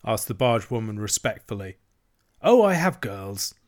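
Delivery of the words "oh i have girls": light. A narrator's reading. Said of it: "said Toad lightly".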